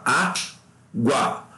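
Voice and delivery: Água in two separated beats, á-gua; two-syllable division. Shown as a demonstration. Teacher repeats the split for students, Água in two beats. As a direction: pause after Á, then gua in one glide. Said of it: "você fala água".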